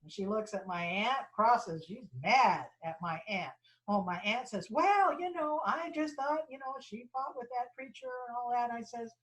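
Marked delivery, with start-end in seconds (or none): 4.7-8.7 high-pitched retold excuse, easing off; defensive excuse. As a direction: justify yourself to the angry mom, as the aunt. Aunt justifies herself to angry mom.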